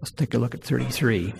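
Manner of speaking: ordinary talk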